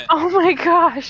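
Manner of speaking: high, loud delighted squeal; delighted shock. Real, not acted. At the lizard picture, high delighted squeal. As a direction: squeal at the cute hat.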